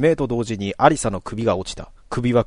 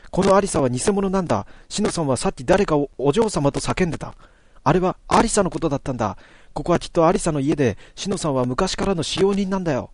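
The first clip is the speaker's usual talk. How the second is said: put-on higher girl's voice, explaining quickly; eager girl reasoning; 6 semitones higher than usual. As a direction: lay out the deduction like a young detective. Natsumi explains the fake Alisa. (少女の声で推理を語る)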